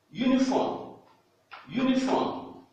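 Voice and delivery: Uniforme dit haut, U comme you; English model word. Shown as a demonstration. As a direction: Uniforme en modèle, voix haute. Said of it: "exemples pour montrer la prononciation U". (Uniforme à l'anglaise, voix haute)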